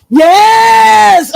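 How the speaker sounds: a real burst of joy, loud and high; real excited yell; excited shout. Real, not acted. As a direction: shout it out in delight. Excited about the illustrator.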